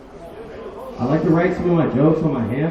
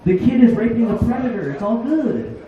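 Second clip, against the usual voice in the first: frantic denial; 7 semitones higher than usual. Retold or imitated. Defending joke to girl.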